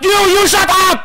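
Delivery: loud high playful yell; mock shout. Real, not acted. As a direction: yell back in jest. Banter with teammate.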